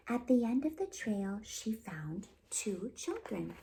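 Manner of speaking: put-on lively, expressive read-aloud for little kids; storytime narration. A narrator's reading. Children's librarian reading a fairy tale to kids, lively storytelling.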